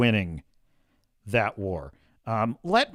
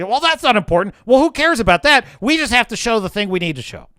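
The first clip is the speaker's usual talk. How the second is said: dismissive executive; 6 semitones higher, 4 dB louder, faster than usual. Pretend. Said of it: "I say".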